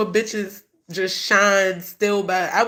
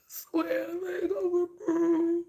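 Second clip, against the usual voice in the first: higher, broken, crying through the words; real crying; 8 semitones higher, 6 dB quieter, slower than usual. Real, not acted. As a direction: voice cracks into sobs. Grieving mother breaks down.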